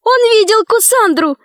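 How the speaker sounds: put-on high, urgent girl's cry; high-pitched excited cry; shouted. A narrator's reading. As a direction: call out the accusation in a high voice. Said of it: "закричала Алиса".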